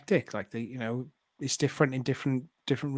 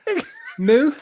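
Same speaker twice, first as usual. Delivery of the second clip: put-on high, loud Liverpool-accent moo; Scouser cow moo; 13 semitones higher, 8 dB louder, slower than usual. Scouse moo asked for by a friend, higher and louder. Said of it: "Do a, do a Scouser".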